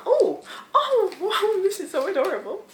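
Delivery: high-pitched real cooing delight. Real, not acted. Vlogger seeing her friend's package, delighted. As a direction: gush over something adorable in a high voice.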